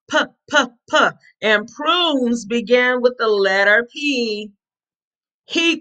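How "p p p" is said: "P" as a short puh, not pee; P sound. Shown as a demonstration. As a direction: separate puh bursts for each "P". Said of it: "what's the P sound".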